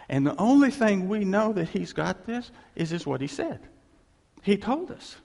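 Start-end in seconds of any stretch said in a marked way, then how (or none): none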